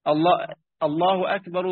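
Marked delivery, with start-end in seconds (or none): none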